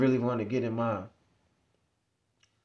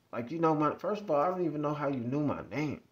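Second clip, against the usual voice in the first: usual speaking voice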